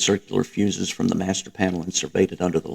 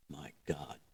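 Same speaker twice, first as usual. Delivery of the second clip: almost soundless, much softer gasp of words; breathed; 5 semitones lower, 22 dB quieter than usual. A narrator's reading. Stunned minister reacting to Hitler's vision, breathed. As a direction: let it out on a breath in shock. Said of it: "Speer breathed".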